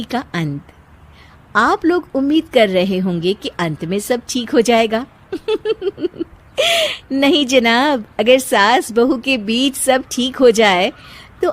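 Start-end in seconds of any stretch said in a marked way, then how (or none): 5.3-6.2 teasing laugh. Real, not acted. Narrator's teasing aside.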